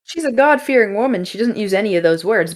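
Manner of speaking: usual speaking voice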